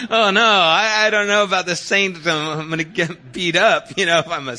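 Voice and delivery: put-on high, loud, frightened child voice; a kid panicking about getting beaten up, high and loud; boy afraid of being called a snitch, high loud panic; panicked kid. Kid fears becoming a snitch.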